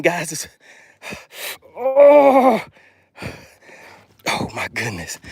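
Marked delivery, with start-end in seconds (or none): 1.8-2.6 high, drawn-out "oh" of effort; high groan mid-struggle; strained groan. Real, not acted. Struggling to land the fish.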